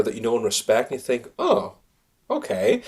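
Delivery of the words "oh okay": high, drawn-out mock surprise; a sudden high "oh, okay" of realisation; surprised. Surprised thought on hearing rival chosen.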